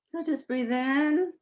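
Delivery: calm, unhurried breathing guide with long pauses; guided breathing. Acted. Leads breathing before recitation.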